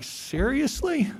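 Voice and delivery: mock-stunned questioning; incredulous. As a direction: stretch it out, rising, as if you can't believe it. Acts out disbelieving reaction to news.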